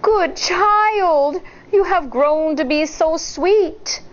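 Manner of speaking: high sweet fake granny; wolf as granny. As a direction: croon like a hungry wolf. Wolf embracing child.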